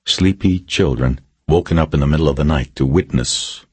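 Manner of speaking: usual speaking voice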